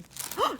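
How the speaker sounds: sharp high gasp of discovery; delighted gasp. Real, not acted. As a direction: gasp as the page turns up. Finds the Bible verse.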